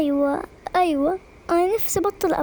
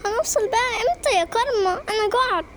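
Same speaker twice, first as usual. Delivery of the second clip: ordinary talk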